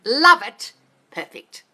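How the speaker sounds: high, loud burst of delight. Real, not acted. Cheering a viewer's affirmation, loud and delighted.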